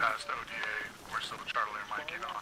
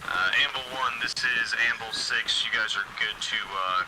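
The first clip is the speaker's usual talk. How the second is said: clear, raised command voice; loud radio call; 2 semitones higher, 4 dB louder than usual. Real, not acted. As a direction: call it over the net.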